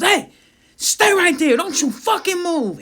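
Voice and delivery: very high, loud command; shouted police order. Retold or imitated. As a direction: imitate an alarmed cop yelling to freeze. Officer shouts order at driver.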